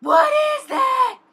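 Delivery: high, loud, slow outcry; shocked shout. Real, not acted. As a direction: yell it out in disbelief. Shocked by the smell.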